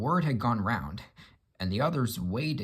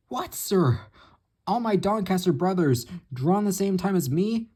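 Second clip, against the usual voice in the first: much higher, louder shocked cry; shocked dismay; 9 semitones higher, 6 dB louder than usual. Acted. Gordon hears his brothers are gone, shocked cry. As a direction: cry out in stunned disbelief.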